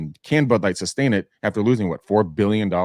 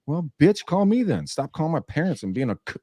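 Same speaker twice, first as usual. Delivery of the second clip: higher, mocking retort; defiant comeback; 7 semitones higher than usual. Answering the gossips with a higher, sassy mock comeback. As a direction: fire back at the gossips with sass. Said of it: "I'm like".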